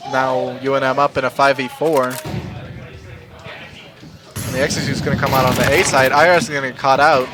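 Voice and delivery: caster rising from calm to hype; steady call swelling into excitement; play-by-play commentary building up. Acted. Casting the match, building hype.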